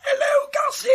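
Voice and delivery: very high strangled squeak; being throttled. Acted. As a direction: squeeze out a greeting while choked. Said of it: "who are you throttling now?".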